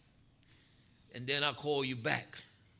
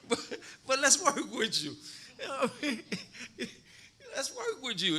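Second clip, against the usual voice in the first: higher, louder, laughing through the words; 9 semitones higher, 5 dB louder than usual. Real, not acted. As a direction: chuckle as you talk. Laughing through coaching quote.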